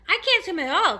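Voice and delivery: high, loud distressed confession; panicked cry. A narrator's reading. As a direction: cry out in alarm. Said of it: "cried the ladybug".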